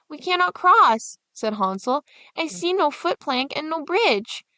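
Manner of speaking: put-on high, slow, fretful boy's voice; stuck at the water, troubled; worried boy. Hansel at the great water, worried in a high slow boy's voice.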